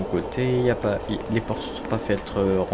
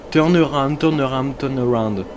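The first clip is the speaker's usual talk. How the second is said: thick French accent on English words; English in a homegrown French accent; 2 semitones higher than usual. Shown as a demonstration. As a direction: repeat it with a heavy French accent. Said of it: "mon accent anglais, là, de qualité".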